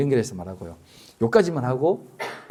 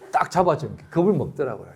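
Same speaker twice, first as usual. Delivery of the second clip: usual speaking voice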